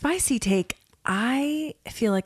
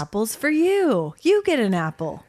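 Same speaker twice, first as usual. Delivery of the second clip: Oprah-style giveaway; 3 semitones higher than usual. Acted. Playing along as the Oprah of apples, giveaway call. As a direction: hand out prizes like a talk show host. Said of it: "He's the Oprah of apples".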